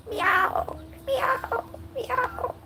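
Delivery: very high, thin kitty meows; cat meow. Pretend. Owner meows to her cat, high imitation meow.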